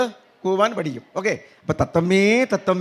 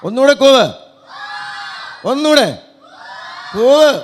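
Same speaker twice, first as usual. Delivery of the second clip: higher and louder, rallying the crowd; loud countdown; 7 semitones higher, 7 dB louder than usual. Real, not acted. Performer counting the crowd in to hoot, loud. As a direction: fire them up to hoot.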